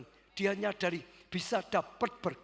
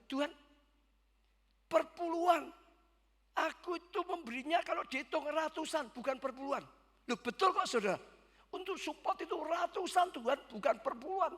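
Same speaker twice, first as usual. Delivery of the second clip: high, raised, exasperated objection; indignant protest; 9 semitones higher than usual. Retold or imitated. Pastor angrily telling God he gave far more, high and raised. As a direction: argue back at God in outrage. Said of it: "lebih marah dengan Tuhan".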